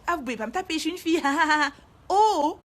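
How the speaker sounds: high, loud protest breaking into laughter; acted protest. Strapped-down girl protesting to the fireman, mock indignant cry. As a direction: object shrilly, then burst out laughing.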